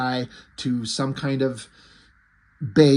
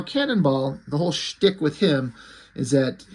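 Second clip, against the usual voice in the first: ordinary talk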